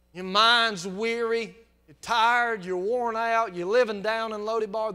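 booming, drawn-out pulpit cry; loud slow preaching. Real, not acted. Preacher crying out to the weary, slow and loud. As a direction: lean into each weary line.

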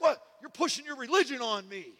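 high, loud offended protest; indignant neighbor. Retold or imitated. Neighbor in the laundromat accusing him, loud and high. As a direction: throw the accusation back, affronted. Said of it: "He's like".

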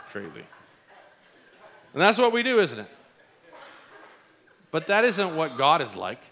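normal talking voice

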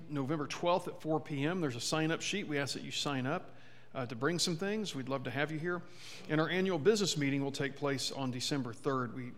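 normal talking voice

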